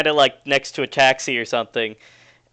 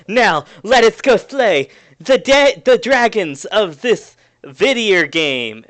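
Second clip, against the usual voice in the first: slow, loud, higher heroic proclamation; grandiose hero speech; 5 semitones higher, 4 dB louder, slower than usual. Acted. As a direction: declaim it like a storybook knight. GM voices heroic NPC speech.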